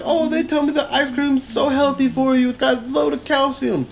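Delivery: high put-on announcer voice of the ice cream man; mock ice cream man. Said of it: "He's like".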